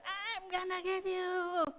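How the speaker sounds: high, long-drawn mock menace; playful Goliath threat. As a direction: stretch it out, chasing a child in play. Aunt chases cornered child in play.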